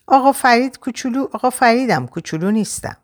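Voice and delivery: put-on high-pitched child voice; little boy. A narrator's reading. Boy correcting a grown-up, high child voice. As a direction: protest in a young boy's high voice. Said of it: "پسر بچهٔ هفت هشت سالهٔ توپلی".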